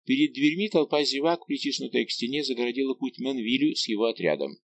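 usual speaking voice

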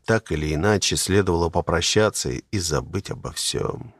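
plain reading voice

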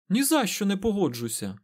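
high-pitched, insistent refusal; stubborn. A narrator's reading. Egwene won't back down before Aes Sedai, stubborn. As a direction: dig in obstinately, without real muttering. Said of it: "вперто пробурмотіла вона".

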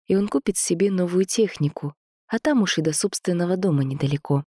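ordinary delivery, nothing marked

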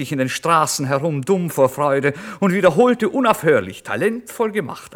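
loud, high, exultant narration; ecstatic joy. A narrator's reading. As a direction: whoop it out, giddy with joy. Wild joy over the acceptance.

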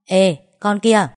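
loud, drawn-out hail to a friend; ringing call-out. A narrator's reading. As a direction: sing out a loud, slow "hey you". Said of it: "nghe giọng nó thánh thót".

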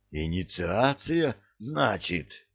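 put-on hoarse, breathy old man's rasp; hoarse wheeze. A narrator's reading. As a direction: rasp it out, clutching the throat. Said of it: "прохрипел старик".

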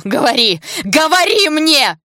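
high, very loud, drawn-out yell; screamed demand. A narrator's reading. Polina shaking Sasha by the shoulders, screamed demand. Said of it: "закричала во всю мощь лёгких".

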